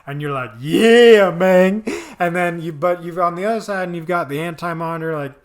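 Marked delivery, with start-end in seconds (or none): 0.6-1.8 high, loud fan whoop; mock fanboy shout of approval; hyped-up cheer. Voicing a hyped-up viewer.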